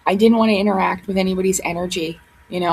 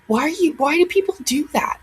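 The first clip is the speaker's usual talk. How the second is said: normal talking voice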